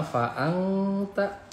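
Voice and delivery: "fa'angta" with a long hummed ng; ikhfa recitation. Shown as a demonstration. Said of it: "dibaca eng jadi samar".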